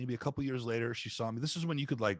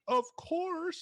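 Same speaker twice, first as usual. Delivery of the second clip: eager yes; 4 dB louder than usual. Retold or imitated. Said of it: "And I was like".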